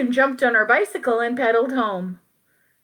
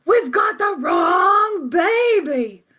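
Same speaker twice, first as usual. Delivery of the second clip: yelling; 7 semitones higher, slower than usual. Acted. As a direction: shout it, slowing on "wrong baby". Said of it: "And he yelled".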